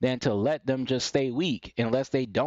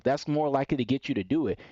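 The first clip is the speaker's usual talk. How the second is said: normal talking voice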